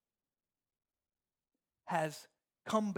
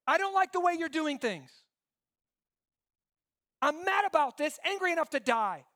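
whiny complaint pitched high and louder; sulky complaint; 7 semitones higher, 3 dB louder than usual. Retold or imitated. Jonah complaining to God, high sulky whine. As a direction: whine it up high like a sulking child. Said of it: "and he's going".

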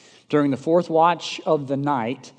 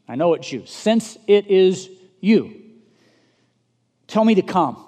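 higher, louder, slowed pleading; eager Peter; 6 semitones higher, 8 dB louder than usual. Retold or imitated. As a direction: beg to come out on the water. Peter eagerly asking Jesus.

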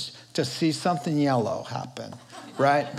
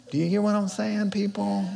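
usual speaking voice

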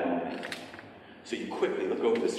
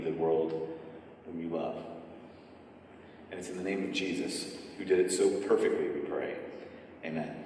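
prayer; 5 semitones lower than usual. Acted. Pastor leads closing prayer.

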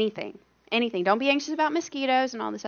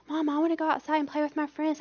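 put-on higher-pitched pleading child voice; imitated daughter; 7 semitones higher than usual. Daughter asks mom to go outside, imitated high child voice. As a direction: voice a little girl asking Mom. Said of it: "my daughter would say".

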